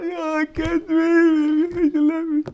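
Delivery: mock heartbroken whine. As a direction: ham up a dumped guy's self-pity. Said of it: "Crying like a little baby".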